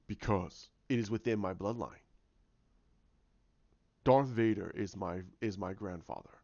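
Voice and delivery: lightly voiced villain, pitched low; a hint of dark menace; brooding villain. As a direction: declare it low and solemn. Villain declares his dark bloodline.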